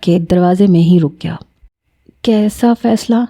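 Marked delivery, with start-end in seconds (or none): 2.2-3.2 mildly fearful, hesitant question; a touch of dread in the asking; trembling lips. A narrator's reading. Granddaughter asks about grandfather's decision, faintly trembling. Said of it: "کپکپاتے ہونٹوں کے ساتھ پوچھا".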